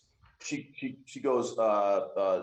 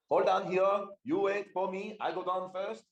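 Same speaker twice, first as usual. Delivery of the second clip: much higher, simple broken-speech cat warrior; high Thunder voice; 9 semitones higher than usual. Acted. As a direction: pitch up high and keep it plain and blunt.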